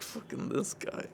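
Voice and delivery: a mock whispered grumble, almost no voice; hushed mocking grumble, barely voiced; muttering under breath. Border guard grumbling to his buddy about the polite American, whispered. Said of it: "He's like".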